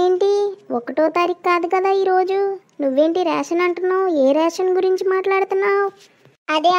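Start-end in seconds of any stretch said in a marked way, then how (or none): none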